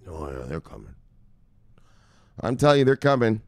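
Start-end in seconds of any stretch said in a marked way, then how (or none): 0.1-0.9 hushed. Real, not acted. Hushed ominous aside.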